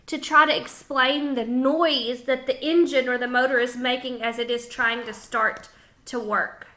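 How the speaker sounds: ordinary delivery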